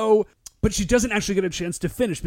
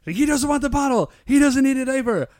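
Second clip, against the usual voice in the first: higher, louder flustered helplessness; a dad at his wits' end; helpless panic; 8 semitones higher, 4 dB louder than usual. Retold or imitated. Goro tells Mom he is out of ideas, higher flustered. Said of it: "He's like".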